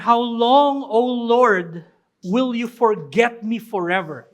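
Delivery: loud anguished cry to God; David's cry. Retold or imitated. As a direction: call out the question with force. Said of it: "Sabi niya". (malakas na daing sa Diyos)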